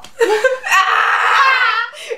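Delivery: high, loud burst of laughter. Real, not acted. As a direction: crack up loudly, high-pitched. Laughs after her Norwegian quote.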